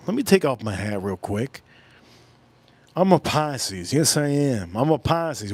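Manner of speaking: ordinary talk, nothing marked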